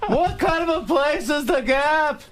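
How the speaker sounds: high, stretched-out yelling observational comic; Jerry Seinfeld. Acted. Comic complaining about Gap beach pants, high slow yelling. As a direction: yell each question in incredulous high pitch. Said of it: "we're just yelling back and forth".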